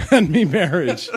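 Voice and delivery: high Irish lilt, laughing; laughing Irish leprechaun voice. Acted.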